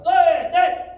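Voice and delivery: high, explosive counting yell; drill command shout. Real, not acted. Instructor calling kicks for students, shouting. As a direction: bellow the count to drive the class.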